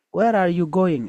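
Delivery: mother shouts at Nyekwi as she leaves. A narrator's reading. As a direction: call out sharply after the girl. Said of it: "her mother shouted".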